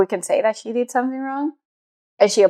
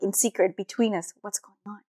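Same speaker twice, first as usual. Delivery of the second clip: soft and very quiet, like sharing a secret; niece's secretive hush; 10 dB quieter than usual. Retold or imitated. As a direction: drop to a hushed, private murmur. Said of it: "compare that to my niece's approach".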